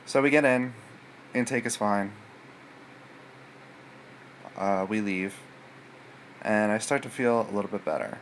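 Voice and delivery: usual speaking voice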